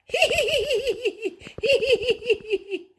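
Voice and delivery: very high, pulsing little squeals; piglets' squealing hee-hee. Acted. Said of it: "all the piglets went".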